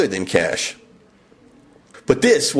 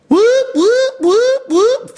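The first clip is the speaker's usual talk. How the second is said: siren imitation; 4 dB louder, much slower than usual. Imagining an alarm going off.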